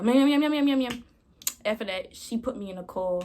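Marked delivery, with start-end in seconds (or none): 0.0-0.9 higher, louder repeated meows; mock meowing. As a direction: meow like nagging noise in your ears. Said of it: "I just heard".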